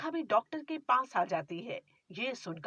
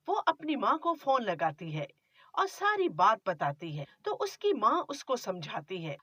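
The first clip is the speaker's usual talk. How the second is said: ordinary delivery, nothing marked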